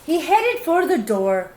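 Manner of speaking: usual speaking voice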